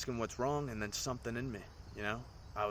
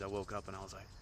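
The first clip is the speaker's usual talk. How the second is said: ordinary talk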